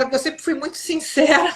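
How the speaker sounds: amused, half-laughing admission. Real, not acted. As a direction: let a real laugh break into the line. Laughing while telling her frankness.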